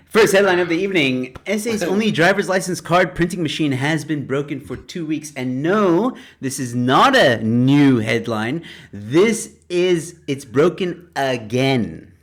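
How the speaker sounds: loud show-host read; announcer. Acted. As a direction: announce the headline with punch.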